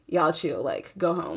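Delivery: low, soft imitation of a calm officer; unraised voice. Officer telling the couple to go home, unraised voice. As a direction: keep it low and quiet, no fuss. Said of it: "officer wasn't raising his voice".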